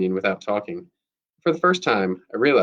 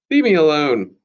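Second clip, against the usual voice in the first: louder, higher plea to be left alone; annoyed outburst; 5 semitones higher, 6 dB louder than usual. A narrator's reading. Telling Lucy or a classmate to back off, annoyed outburst. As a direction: push it out loudly, with annoyance. Heated exchange, told to back off.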